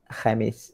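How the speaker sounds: Khamis with a rasped throat kh, slowly; Khamis, full kh; correct Khamis. Shown as a demonstration. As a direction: model Khamis carefully. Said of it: "bukan Kamis".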